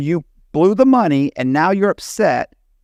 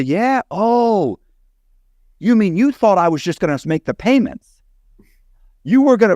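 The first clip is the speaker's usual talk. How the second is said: pitched up high in exaggerated disbelief; mock incredulous; 7 semitones higher than usual. To the client who blew the money, mock amazed. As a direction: feign shock, rising higher.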